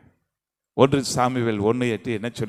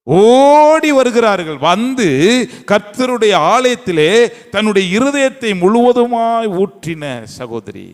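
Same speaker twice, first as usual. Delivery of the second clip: higher, slow, drawn-out sermon cry; impassioned preaching; 8 semitones higher, 5 dB louder than usual. Acted. Preacher proclaiming Hannah's surrender, impassioned. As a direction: lift each word to the hall.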